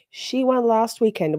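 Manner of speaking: ordinary talk, nothing marked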